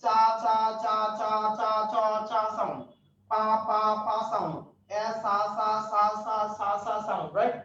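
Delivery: repeating চা, পা and সা as bare syllables; isolated initial sounds. Shown as a demonstration. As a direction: drill the opening sounds one by one. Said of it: "initial sound". (প্রথম ধ্বনি আলাদা করে উচ্চারণ)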